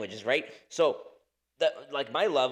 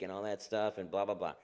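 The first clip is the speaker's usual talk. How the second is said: ordinary talk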